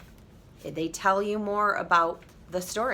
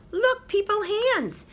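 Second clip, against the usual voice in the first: delighted mother; 12 semitones higher, slower than usual. Acted. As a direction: marvel at the baby like a happy mom. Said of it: "and said".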